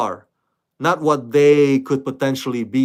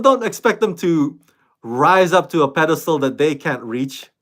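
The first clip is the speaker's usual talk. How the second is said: ordinary talk, nothing marked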